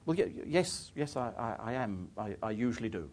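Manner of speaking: hesitant, subdued self-imitation; sheepish reply. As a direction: answer meekly, tripping over the yes. Meek reply to the bossy woman.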